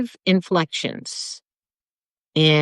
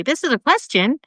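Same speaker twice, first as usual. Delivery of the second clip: "This is a question" in a raised, higher voice; up inflection; 8 semitones higher than usual. Shown as a demonstration. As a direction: lift "This is a question" high like asking. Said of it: "use the up inflection to say".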